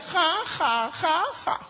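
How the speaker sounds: lightly voiced boy's mock laugh; a cheeky boy sneering ha-ha; mocking kid laugh. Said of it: "el niño de nueve le hace".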